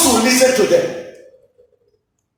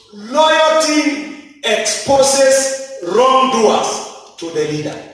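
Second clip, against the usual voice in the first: slow, stressed principle; 3 semitones higher, slower than usual. Acted. Preacher stating a principle slowly.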